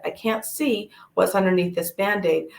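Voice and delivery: ordinary talk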